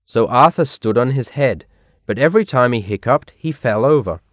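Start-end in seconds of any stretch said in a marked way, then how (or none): none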